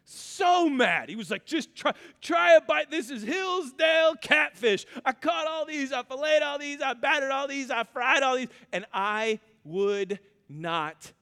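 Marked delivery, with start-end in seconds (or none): 1.5-8.5 higher, louder insistent dad voice; mad dad. Retold or imitated. Father insisting his son try the catfish, mock angry. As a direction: push the plate on the boy, exasperated.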